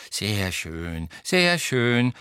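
put-on kindly old examiner's approval; murmured old-man praise, said twice; friendly old wizard. A narrator's reading. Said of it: "sagte der alte Zauberer freundlich".

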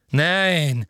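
high, loud, flustered gasp of a word; breathless. A narrator's reading. Umbridge backs down before Kingsley, breathless. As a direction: blurt it out short of breath. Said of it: "sagte Umbridge atemlos".

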